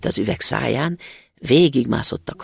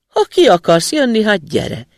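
student answering; 7 semitones higher, 7 dB louder than usual. A narrator's reading. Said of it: "mondta a diák".

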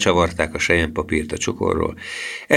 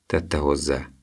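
usual speaking voice

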